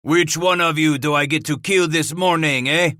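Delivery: high, loud taunting call. Acted. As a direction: call the challenge across the wall. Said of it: "where he called out".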